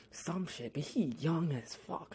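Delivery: normal talking voice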